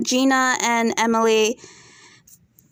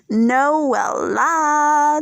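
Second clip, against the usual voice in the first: drawn-out name; 3 semitones higher than usual. Real, not acted. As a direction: savour NOELLA, holding each vowel very long. Savouring the next cast member's name.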